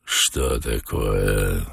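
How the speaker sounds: very low, slow, soft question; puzzled. A narrator's reading. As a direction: wonder aloud, drawing it out low. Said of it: "в недоумении спросил Воланд". (низко, медленно, в недоумении)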